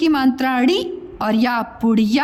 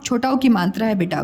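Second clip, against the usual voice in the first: usual speaking voice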